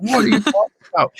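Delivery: high, incredulous question; retold disbelief; mock baffled producer. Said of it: "And he's like".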